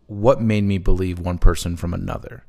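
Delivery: usual speaking voice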